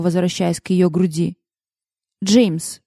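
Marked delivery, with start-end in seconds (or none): 2.2-2.8 mildly cautioning call of a name; warning. A narrator's reading. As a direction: say it as a gentle warning. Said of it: "предупреждающе произнесла Лиззи". (предупреждающе)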